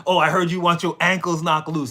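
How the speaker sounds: loud, slangy come-on; mock slang talk. Showing how the smart seducer doesn't talk, loud mock slang. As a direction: throw it out loudly as a crude pickup line. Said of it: "they don't talk like that".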